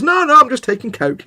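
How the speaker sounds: high, loud imitation of a protest; mock denial. Co-host mimics his friend denying the sniffles, mock protest.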